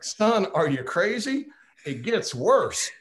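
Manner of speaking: slow and high, teasing the eager newcomer; Tony's voice. Retold or imitated. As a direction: play the wry old board member, stretching each word. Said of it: "I used to do Tony's voice".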